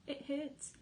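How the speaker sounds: a touch of pained fox. A narrator's reading. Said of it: "said Mister Fox".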